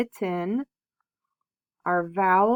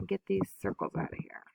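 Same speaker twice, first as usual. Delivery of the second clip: whispered aside; 9 dB quieter, much faster than usual. Real, not acted. Aside to herself while clearing.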